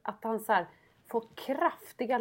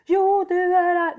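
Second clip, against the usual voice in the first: put-on stubborn child; 8 semitones higher, 8 dB louder, slower than usual. Voicing stubborn son.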